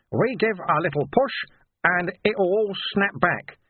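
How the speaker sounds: high, easy character voice; breezy Sweeper. A narrator's reading. Sweeper reassuring Vimes about the return, high and breezy. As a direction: make it sound simple, stretching the snap back.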